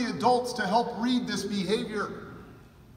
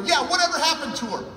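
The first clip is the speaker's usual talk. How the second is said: quick, higher, louder curious ask; eager question; 6 semitones higher, 5 dB louder, faster than usual. Retold or imitated. Asking the caller eagerly about the girl, quick and bright. Retelling phone call, excited question.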